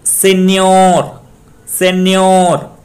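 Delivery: सेन्योर with a high, very long, loud न्योर; stress on last syllable. Shown as a demonstration. Teacher recaps the rule, stressing न्योर in सेन्योर. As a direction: belt out and hold न्योर in सेन्योर. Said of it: "last syllable पे डालें".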